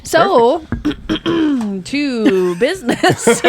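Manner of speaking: slow, half-laughing businesslike tone; mock formal. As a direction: announce it with playful formality. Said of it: "Very formal".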